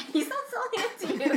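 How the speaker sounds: high, fast, through laughter; laughing. Real, not acted. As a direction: blurt it while cracking up.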